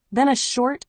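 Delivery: plain reading voice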